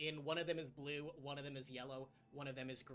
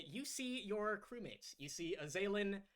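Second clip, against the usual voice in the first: ordinary delivery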